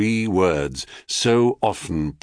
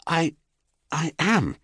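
shy and proud; 9 semitones higher than usual. A narrator's reading. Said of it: "mixture of diffidence and pride".

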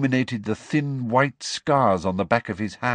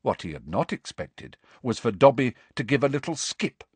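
usual speaking voice

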